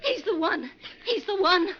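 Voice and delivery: high, loud frantic repeat; shrill-edged accusing cry; hysterical accusation. Acted. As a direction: point him out in panic, rising. Woman accusing after the fight.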